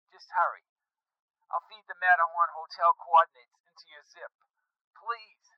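high, anxious radio voice; frightened and pressing; urgent pleading. Acted. Mark urges him to hurry.

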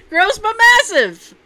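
high, loud, slow proclamation; exclaimed echo. Acted. Host repeating the verdict on food portions, exclaimed. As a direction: belt it out slowly with amusement.

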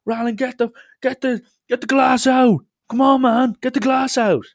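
imitated queue shouting his name; crowd yelling. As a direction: bellow it like an angry pub queue. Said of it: "the whole queue is against me going".

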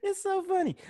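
high, giggling through the okays; trying to calm down mid-laugh; stifled giggles. Real, not acted. Laughing fit after his joke.

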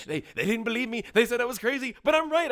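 put-on high, loud, insistent rant of the town drunk nobody believed; vindicated crazy drunk. Podcaster voicing the drunk pilot, shrill and insisting. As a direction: shout it like the crazy guy who turned out right.

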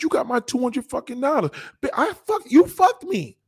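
high-pitched furious yelling at her; shrill cheated rant; mock angry yell. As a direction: yell it at her like she robbed you. Yelling at woman with his money.

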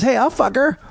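high-pitched call from the crowd; second heckler. Retold or imitated. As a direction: imitate an audience member piping up. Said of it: "another guy goes".

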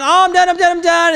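high, urgent retold cry of surrender; mock pained pleading. Climber in pain calling to come down, mock pleading. Acted giving up in intense pain.

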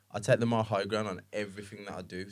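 ordinary talk

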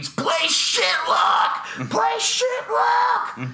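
drawn-out, high-pitched crowd scream; imitated fan screaming. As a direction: scream a slow song request at the band. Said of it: "all start screaming".

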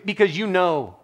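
usual speaking voice

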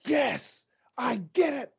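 high, strained victory cry; imitated triumphant yell. Podcaster imitating a bowler after his winning strike, high triumphant yell. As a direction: mimic a champion crowing at the camera. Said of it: "he looks at the camera and he goes".

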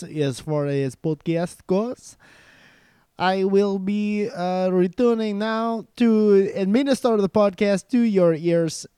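loud, hammed-up Russian cousin; bad Russian accent. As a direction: boom it out like a pompous Russian relative. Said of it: "do a bad Russian accent".

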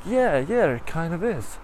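high, swooping agreement; agreeing runner. Acted. Runner conceding to singing tiger, high and agreeable. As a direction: voice lifting as it comes round.

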